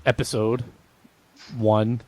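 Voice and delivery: word by word with long pauses; halting sign-off. Real, not acted. Host closing the episode, oddly halting. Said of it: "Why did you say it like that?".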